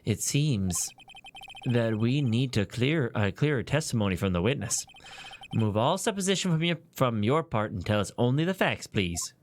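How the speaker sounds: low, measured judge voice; stately judge. Acted. Judge asks witness for clearer testimony, low and stately.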